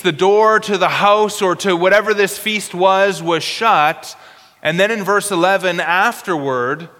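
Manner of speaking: ordinary delivery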